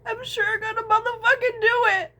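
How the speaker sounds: high, loud sobbing vow; forcing the words out through sobs; real crying. Real, not acted. Vlogger vowing through tears, sobbing loudly.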